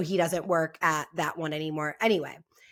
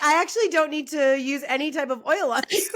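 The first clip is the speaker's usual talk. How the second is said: laughing mock quote; 7 semitones higher, 4 dB louder than usual. Friend mocks masseuse, laughing.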